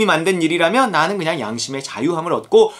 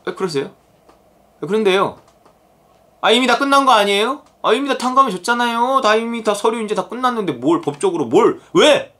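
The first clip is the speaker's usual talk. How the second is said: high-pitched mock protest; talking back; 6 semitones higher than usual. Forgiven servant questioning the master, talking back. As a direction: argue back indignantly, pitched up. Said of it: "하나님께 이렇게 대드는 판이에요".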